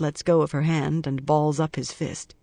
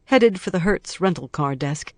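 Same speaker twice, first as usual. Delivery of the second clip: plain reading voice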